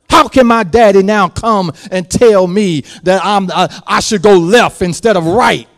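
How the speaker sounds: ordinary delivery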